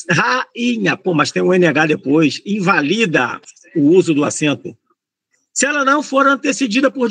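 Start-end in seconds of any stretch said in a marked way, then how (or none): 0.1-1.0 "Rainha" split as ra-i-nha; syllable by syllable. Shown as a demonstration. Teacher checking what follows the vowel, "Rainha" syllable by syllable. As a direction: separate the "i" in "Rainha" from its neighbours. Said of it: "o I ficou isoladinho".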